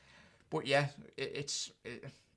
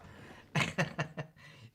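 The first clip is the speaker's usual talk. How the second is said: breathy laugh; 3 semitones higher than usual. Real, not acted. Laughs at own joke.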